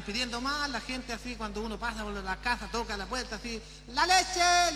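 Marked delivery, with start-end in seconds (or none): 3.9-4.7 high, loud street-vendor call; calling out wares. Retold or imitated. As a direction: call it out to the houses. Milkman calling at doors.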